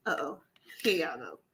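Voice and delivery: breathy sneaky whisper. Real, not acted. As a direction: whisper it like a secret. Sneaky aside while sharing screen.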